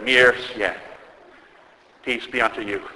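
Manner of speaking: composed blessing. Retold or imitated. Bishop blesses the people once order is back, composed. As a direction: give the blessing calmly and evenly, letting it fall away. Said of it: "The bishop composed himself".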